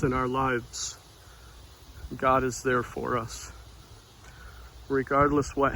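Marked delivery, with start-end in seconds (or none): none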